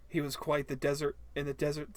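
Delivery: ordinary talk, nothing marked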